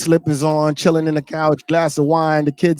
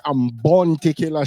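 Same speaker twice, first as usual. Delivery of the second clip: put-on Jamaican accent; slower than usual. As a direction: mimic the Jamaican radio voice, mouth full of air. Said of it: "She full of mouth full of air".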